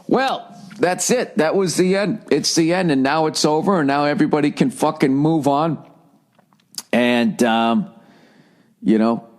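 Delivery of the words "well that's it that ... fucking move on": mock proclamation. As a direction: proclaim it like a sarcastic herald. Mock triumphant proclamation, it's over.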